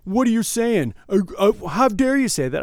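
offended, stammering protest; indignant. Retold or imitated. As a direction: play an affronted drunk gambler. Acted indignant customer in argument.

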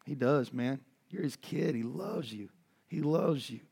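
ordinary delivery

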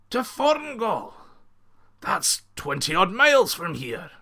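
high, loud disbelieving outburst; shocked protest. A narrator's reading. Bandit chief protesting the long walk, high and loud. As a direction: cry out at the distance in disbelief.

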